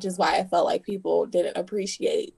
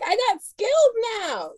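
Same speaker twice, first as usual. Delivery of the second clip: excited boast; 11 semitones higher than usual. Acted. Celebrating new skills cheerfully.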